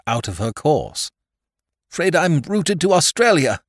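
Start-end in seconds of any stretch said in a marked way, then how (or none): 1.9-3.6 breathless, high apology; panting apology. A narrator's reading. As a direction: pant it out sheepishly. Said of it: "panted Peter apologetically".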